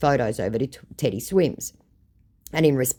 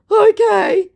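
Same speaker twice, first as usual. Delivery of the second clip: scammer voice; 11 dB louder than usual. Retold or imitated.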